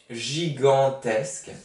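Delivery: gigantesque said slowly, syllable by syllable; slow dictation reading. Shown as a demonstration. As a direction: spell it out with your voice for the class. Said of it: "dictation-style slow repeat".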